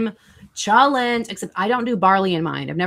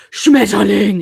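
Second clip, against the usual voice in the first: loud, harsh mock "Schmetterling" with a hard SCH and rolled T sounds; mock harsh German; 10 dB louder, slower than usual. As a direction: bark "Schmetterling" like the cliché ugly German word. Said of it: "everyone's like".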